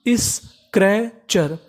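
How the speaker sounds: Scratcher very slowly in separate parts, is-kre-char; syllable by syllable. Shown as a demonstration. Teacher sounding out a word for learners, syllable by syllable. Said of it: "तो meaning क्या बनी".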